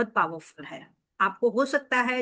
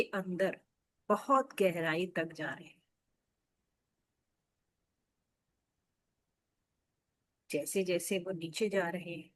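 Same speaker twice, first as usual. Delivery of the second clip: softer, lower, soothing meditation lead; guided meditation; 3 semitones lower, 4 dB quieter than usual. Acted. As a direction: gently guide listeners into deep relaxation, leaving space between phrases.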